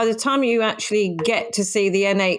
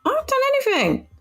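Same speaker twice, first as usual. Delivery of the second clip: high, quick indignant denial; protesting innocence; 14 semitones higher, faster than usual. Real, not acted. Co-host asked what she is doing, high protest of innocence.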